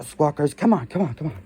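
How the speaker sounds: normal talking voice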